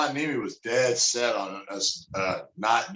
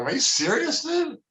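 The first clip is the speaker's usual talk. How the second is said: incredulous. Retold or imitated. Retelling his reaction to the phone call, high disbelief. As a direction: pitch jumps far higher in disbelief. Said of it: "I go".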